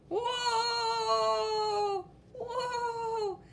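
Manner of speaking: high, long falling whoa; falling cry. Acted. Yellow friend cries out falling in a hole. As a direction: cry out as you tumble down.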